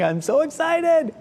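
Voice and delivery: high-pitched, drawn-out mock child's squeal; imitating excited yelling. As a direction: mimic an overjoyed little girl, high and stretched out. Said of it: "Olympia can be heard yelling".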